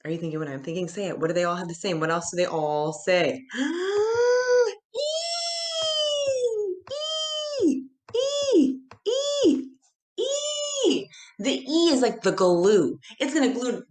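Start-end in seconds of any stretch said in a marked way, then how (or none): 3.5-4.9 sharp high gasp of discovery; big excited gasp. Pretend. Teacher gasping to students in mock surprise. 4.9-11.0 high sung-out E, held long; excited high calls. Pretend. Teacher calling out to the class, very high and excited.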